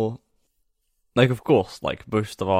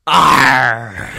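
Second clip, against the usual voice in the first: booming pirate arr; 4 semitones higher, 16 dB louder than usual. Acted. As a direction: roar the letter like a pirate. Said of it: "Talk Like a Pirate Day".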